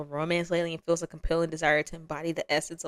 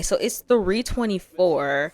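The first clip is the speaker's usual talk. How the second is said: ordinary talk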